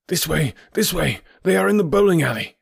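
high, excited calling out; urgent cry. A narrator's reading. Stranger leading the chase through bushes, high urgent cry. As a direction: call them after you urgently. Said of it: "cried the stranger".

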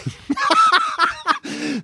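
high burst of laughter; helpless high-pitched laughing; real laugh. Real, not acted. Laughing at own anecdote.

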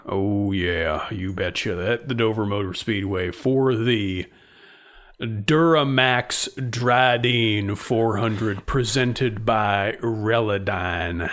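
low, slow hyped race announcer; deep announcer voice. Pretend. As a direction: drop the voice into a nascar promo. Mock low nascar announcer.

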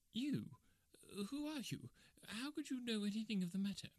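very high, timid little-man voice; high-pitched, wary disbelief; nervous suspicion. Acted. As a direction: question a stranger fearfully. Frightened little man questions stranger.

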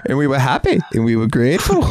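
high, put-on Scottish lilt; mock Scottish accent, lightly hammed; a playful Scottish brogue; Scottish accent impression. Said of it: "[name] doing his".

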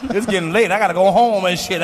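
ordinary talk, nothing marked

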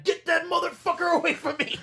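high-pitched imitation of panicked yelling; mock yelling. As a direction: act out yelling at someone to back off. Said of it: "Randy's yelling at him".

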